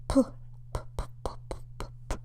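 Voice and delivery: "p" puffed in a whisper, no voice; voiceless p. Shown as a demonstration. Teacher showing voiceless p to students. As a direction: whisper the p sounds.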